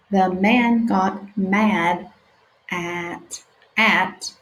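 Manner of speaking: stretched-out sounding out; slow sounding out. Shown as a demonstration. Teacher reading slowly with children. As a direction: read each word slowly for beginners. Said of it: "let's practice together".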